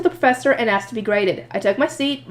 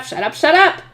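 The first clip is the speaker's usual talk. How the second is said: higher, mildly frantic repeated plea; urgent inner cry; 4 semitones higher than usual. Acted. Silently begging Wilfried to stop talking, frantic. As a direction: build the plea with each repeat. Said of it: "My silent cry for him to".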